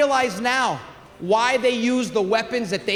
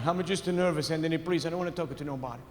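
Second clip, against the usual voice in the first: nervous mumble; 3 semitones lower, 8 dB quieter than usual. Retold or imitated. Nervous father retold.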